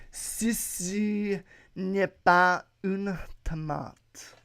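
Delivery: slow, drawn-out French pronunciation; showy French. Acted. Podcaster reads out the French caption, slow showy French. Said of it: "The French".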